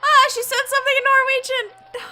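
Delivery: a giddy, shouted outburst of delight; thrilled classmate. Acted. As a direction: gush loudly as if starstruck. Classmates thrilled by demon girl.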